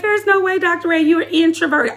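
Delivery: high mock-incredulous voice; people doubting. Imitating people who doubt she's introverted, high and disbelieving. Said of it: "People like".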